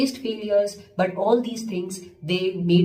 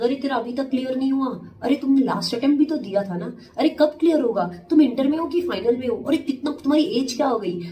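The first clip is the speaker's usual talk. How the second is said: higher, rapid-fire nosy questioning; nagging people; 5 semitones higher, much faster than usual. Retold or imitated. People pestering a student about failed exam attempts, nagging. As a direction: pester like a prying relative, pitched up and quick. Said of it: "people are saying".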